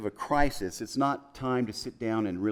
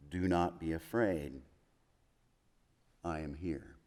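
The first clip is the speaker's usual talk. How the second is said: tender reassurance; 5 semitones lower, 7 dB quieter than usual. Acted. Jesus comforting frightened disciples.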